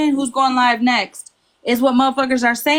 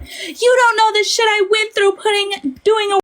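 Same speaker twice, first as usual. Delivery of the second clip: mock scream; 10 semitones higher than usual. Mimicking a woman who screams it in boxes, high mock scream. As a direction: mimic someone screaming a complaint, pitched way up. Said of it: "Mallory screams it in boxes".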